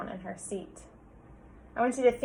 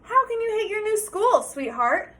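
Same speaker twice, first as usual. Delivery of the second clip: higher, amused father voice; chuckling dad; 10 semitones higher, 6 dB louder than usual. Acted. Father teasing his daughter, lightly amused. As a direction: gently tease her. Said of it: "Mister Hartwell chuckled".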